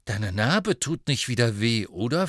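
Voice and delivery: ordinary delivery, nothing marked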